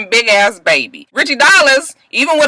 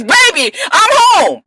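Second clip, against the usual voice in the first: calling out; 9 semitones higher than usual. Retold or imitated. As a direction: call up the stairs. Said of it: "He go to the stairs".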